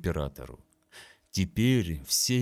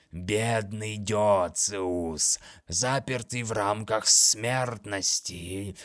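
taunting monster voice; slower than usual. Acted. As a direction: taunt him slowly and cruelly.

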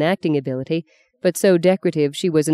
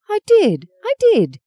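put-on much higher and louder, stubborn childish insistence; insisting; 4 dB louder, slower than usual. A narrator's reading. Sister stubbornly insisting to her brother, high and loud. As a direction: squeal it like a defiant child.

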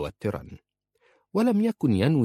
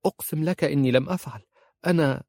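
quick, higher-pitched protest; hurried; 8 semitones higher, faster than usual. A narrator's reading. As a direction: rush out an urgent denial. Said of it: "قالت مسرعة". (باستعجال وبنبرة مرتفعة)

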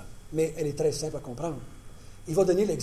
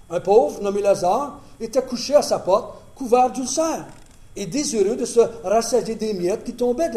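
louder, higher public Bible reading; scripture reading; 6 semitones higher, 9 dB louder than usual. Acted. Preacher reading Luke to the congregation, loud and lifted. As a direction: proclaim the parable to the room. Reads the parable aloud.

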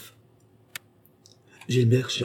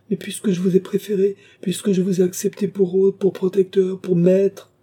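high, raised-voice pleading; desperate protest; 12 semitones higher, 5 dB louder than usual. A narrator's reading. Gilbert protesting his loyalty to Rousseau, desperate. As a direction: insist urgently, piling up each phrase.

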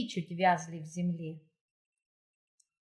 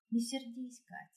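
softly asking, much quieter; gentle plea; 3 semitones higher, 9 dB quieter than usual. A narrator's reading. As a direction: ask softly, almost pleading. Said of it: "попросила она". (тихо, с просьбой)

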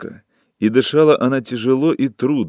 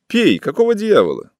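high, scornful jeer; contemptuous; 10 semitones higher, 3 dB louder than usual. A narrator's reading. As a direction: sneer it down at him, much higher. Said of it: "презрительно смотрела на него и говорила".

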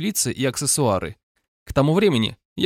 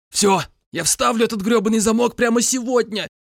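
desperate shout; 9 semitones higher than usual. A narrator's reading. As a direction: yell out surrender through pain. Narrator giving in under pain. (отчаянный крик сдачи)

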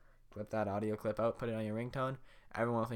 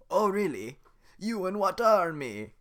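much higher, louder slow sneer; mocking Shrek; 10 semitones higher, 13 dB louder than usual. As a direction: taunt the guard with disdain.

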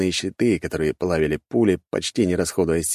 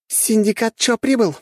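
high, raised female announcement; exclaiming; 4 dB louder than usual. A narrator's reading. Young syndicate woman announcing arrival on the battlefield, exclaiming. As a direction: proclaim the arrival with predatory relish. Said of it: "воскликнула и хищно оскалилась".

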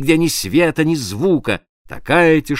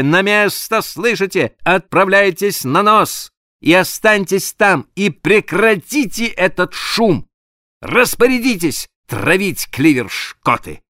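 firm orders; 7 semitones higher than usual. Acted. Captain shaking mate, giving orders.

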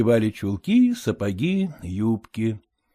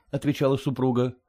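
ordinary delivery, nothing marked

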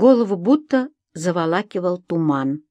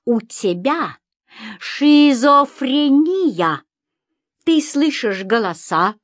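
slow, raised, hammered-out symptoms; accusing list; 5 semitones higher, slower than usual. A narrator's reading. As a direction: pronounce each charge with heavy stress. Husband lists her alleged illness. (обвиняя, с нажимом)

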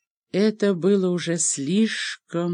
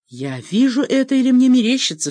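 raised, higher-pitched outcry of disbelief; exclaiming; 7 semitones higher, 6 dB louder than usual. A narrator's reading. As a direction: cry out as if doubting your own eyes. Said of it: "вскричал Кит в тот же момент".

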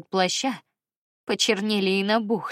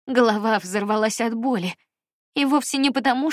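ordinary delivery

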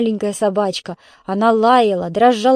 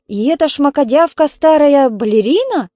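loud, higher, incredulous question; gasping disbelief; 6 semitones higher, 6 dB louder than usual. A narrator's reading. Neighbour learns who the dancer is, astonished. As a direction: scoff in astonishment, swooping up high. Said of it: "ахнула Ольга Петровна".

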